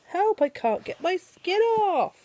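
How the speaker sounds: high, loud, slow mock plea; a hammed-up storybook help; rhino's cry for help. A narrator's reading. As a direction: wail it like a stuck rhino.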